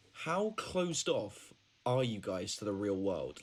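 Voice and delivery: ordinary delivery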